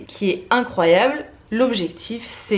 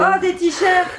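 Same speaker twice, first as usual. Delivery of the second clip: high, laughing gasp of joy; delighted surprise; 9 semitones higher, 4 dB louder, much slower than usual. Real, not acted. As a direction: squeal over the gift. Opening birthday gifts.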